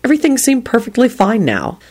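ordinary delivery, nothing marked

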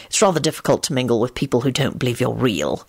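low-pitched, understated wryness; wry. A narrator's reading. Lupin explaining why wizards mingle little, wryly. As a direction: drop the pitch and say it dryly. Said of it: "he said wryly".

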